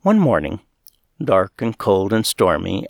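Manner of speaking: ordinary delivery, nothing marked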